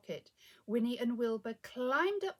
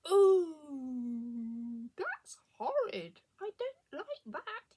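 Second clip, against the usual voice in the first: disgusted space rabbit; 3 semitones higher, 7 dB louder, much slower than usual. A narrator's reading. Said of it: "he said".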